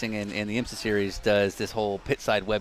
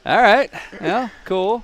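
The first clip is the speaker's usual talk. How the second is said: mock excited reaction; 10 semitones higher, 8 dB louder, slower than usual. Mock reaction to webcast praise.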